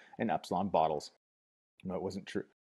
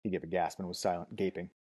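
usual speaking voice

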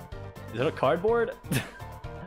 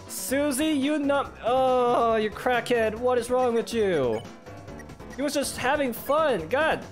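exasperated yelling; 11 semitones higher, slower than usual. Real, not acted. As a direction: yell at her in disbelief, voice soaring. Scolds Susie, appalled.

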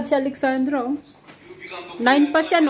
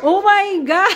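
laughing; 5 semitones higher than usual. Real, not acted. Laughing at messy face.

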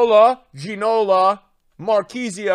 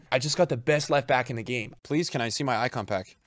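ordinary talk

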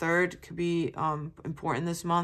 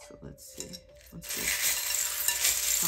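soft breathy whisper while shuffling; whispered to herself; 4 dB quieter, much slower than usual. Real, not acted. Tarot reader whispering to herself while shuffling cards. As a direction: half-whisper as she looks over the cards.

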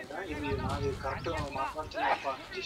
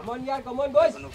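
louder, higher, slowed right down; angry, slow; 10 semitones higher, 10 dB louder, much slower than usual. Real, not acted. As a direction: press every word in anger. Heated phone argument.

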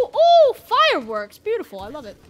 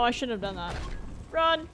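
usual speaking voice

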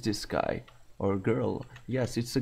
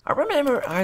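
high, loud squeaky mock line; 5 dB louder, faster than usual. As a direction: squeal it like a silly cartoon. Mocking a cutscene line.

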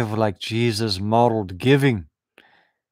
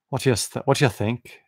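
ordinary talk, nothing marked

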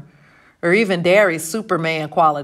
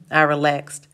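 usual speaking voice

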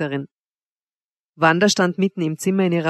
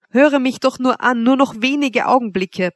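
higher, urgent appeal; begging; 6 semitones higher, 3 dB louder than usual. A narrator's reading. Lover on his knees begs Wanda, urgently. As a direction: beseech for a moment more. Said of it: "bat ich".

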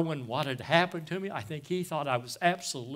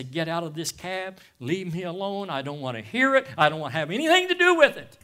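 retold angry yell; 3 semitones higher, 7 dB louder than usual. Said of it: "I'd scream at him and say".

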